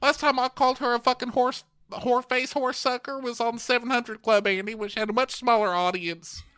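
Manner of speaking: high, airy socialite voice, offhand; breezy, high and unbothered; high mock Deandra. Imitating Deandra shrugging off her insults, high and airy. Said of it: "Deandra's like".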